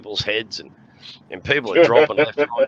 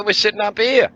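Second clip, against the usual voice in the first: tag only; 10 semitones higher, 5 dB louder than usual. Retold or imitated. Drunk reply to cops.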